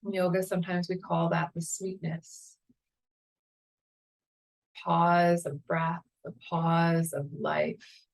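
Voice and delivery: calm, unhurried meditation guide voice; guided meditation. Acted.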